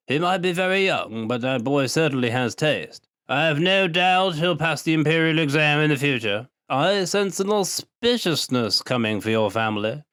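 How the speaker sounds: put-on high, slow, pleased old man's drawl; drawling old farmer. A narrator's reading. Old Leo boasting about his beans to the boy, slow pleased drawl. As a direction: savour the praise like a proud old farmer.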